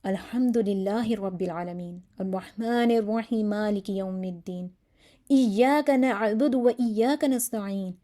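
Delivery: slow, earnest Fatiha from the heart; heartfelt recitation. Shown as a demonstration. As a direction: pray each word slowly, meaning it. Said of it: "یہی دعا بہت دل سے مانگ رہا ہے".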